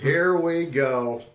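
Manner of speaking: slow gleeful here we go; drawn-out mock excitement. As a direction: stretch it out like a hype moment. Repeated, drawn out.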